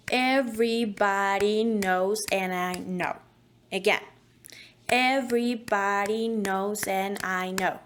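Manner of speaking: slow, each word beaten out clearly; exaggerated rhythm. Shown as a demonstration. Coach giving the phrase a clear exaggerated rhythm. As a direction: tap out "Everybody knows and I know" word by word. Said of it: "let's give it a rhythm".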